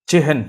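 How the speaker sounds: CHEHEN said loud and high, split che-hen; example word pronunciation. Shown as a demonstration. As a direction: sound out চেহেন as "chi-hen", emphatically. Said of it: "উদাহরণ দেখি আমরা".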